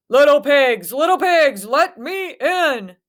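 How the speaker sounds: loud wolf call, slowing on the end; big bad wolf growling at the door; hungry wolf demands entry to the brick house. A narrator's reading. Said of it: "he growled".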